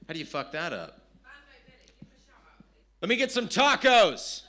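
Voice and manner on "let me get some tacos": higher-pitched put-on customer voice; high, keen customer; acted customer ordering. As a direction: order with comic eagerness. Acts out customer ordering food.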